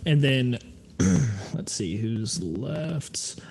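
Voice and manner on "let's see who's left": muttering. Real, not acted. GM mutters to himself.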